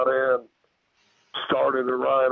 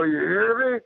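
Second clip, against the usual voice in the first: high-pitched, insistent retold warning; 9 semitones higher than usual. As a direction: re-enact calling up a warning, pitch raised. Retold warning to creature in tree.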